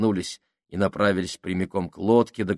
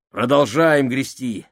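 commanding; 4 semitones higher, 5 dB louder than usual. A narrator's reading. Commanding the rowing.

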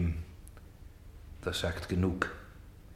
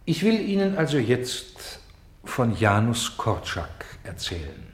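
ordinary talk, nothing marked

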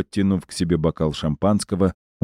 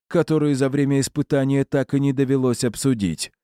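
higher, courteous formal voice; a lightly voiced polite aristocrat; polite gentleman; 7 semitones higher than usual. A narrator's reading. Character voice, Edward Dawson.

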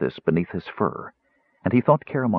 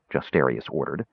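plain reading voice

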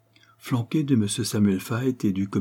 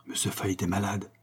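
murmured; 7 dB quieter than usual. A narrator's reading. Poker player murmuring to the others, softly. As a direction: murmur it half to yourself. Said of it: "murmura un des joueurs".

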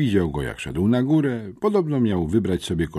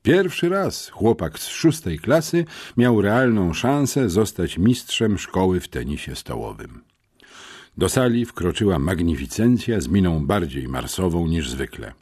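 usual speaking voice

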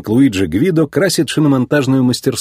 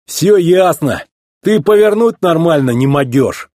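higher, put-on yokel voice; goading tow truck; 6 semitones higher, 3 dB louder than usual. Mater taunts the racer about turning, goading tow truck. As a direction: needle him in a rustic voice.

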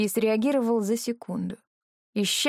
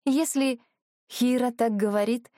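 ordinary delivery

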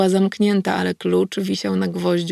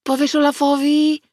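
put-on a higher, boyish voice asking nervously; younger kid; 7 semitones higher, 3 dB louder than usual. A narrator's reading. Frightened boy asks her if she'll tell Olaf, higher voice. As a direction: voice a scared thirteen-year-old. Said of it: "zapytał młodszy dzieciak".

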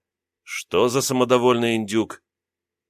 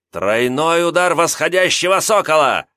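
yelling a battle cry, higher; shouted; 7 semitones higher, 4 dB louder than usual. Acted. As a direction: bellow the attack name. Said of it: "внезапно закричал он".

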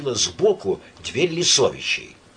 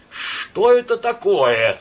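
high, loud, slow puzzled question; baffled demand; 11 semitones higher, 6 dB louder, slower than usual. A narrator's reading. Myshlaevsky demands an explanation, high and loud. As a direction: demand to know, stunned. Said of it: "спросил Мышлаевский".

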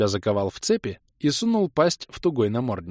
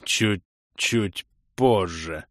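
very slow, strained rasp; hoarse croak; much slower than usual. A narrator's reading. Crusader putting off his sweetheart, slow hoarse croak. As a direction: croak it out word by word. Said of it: "прохрипел алый крестоносец".

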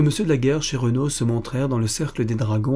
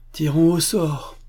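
slow, mildly sad reply; a resigned, understated sigh of a line; sadly; 6 semitones higher, slower than usual. A narrator's reading. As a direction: let it drop with a touch of regret. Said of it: "répondit tristement monsieur d'Aigrefeuille".

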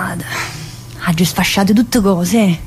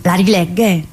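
ordinary delivery

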